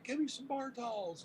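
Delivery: high urgent call; urgent request. Retold or imitated. Telling the bartender to fetch towels, high and urgent. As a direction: demand towels fast.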